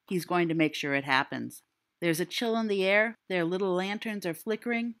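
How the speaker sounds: low, even storytelling read; story narration. A narrator's reading. Narrator setting a Halloween campfire scene, steady storytelling. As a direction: set the scene like a fireside tale.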